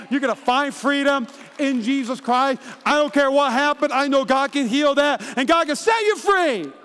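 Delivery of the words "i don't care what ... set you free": louder, rising preacher build; full-force sermon build; preaching climax. Real, not acted. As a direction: drive it home to the congregation.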